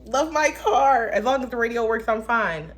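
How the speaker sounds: high, loud airhead voice; lightly hammed-up carefree girl; mock ditzy girl. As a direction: play the carefree simple girl. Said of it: "'cause I be all".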